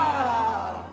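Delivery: loud drawn-out crowd ah. Retold or imitated. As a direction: shout it like an awed crowd. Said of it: "The crowd goes".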